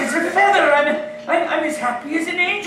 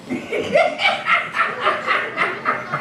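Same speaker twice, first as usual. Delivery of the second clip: delighted laugh; 11 semitones higher than usual. Acted. Scrooge laughs at his own joke.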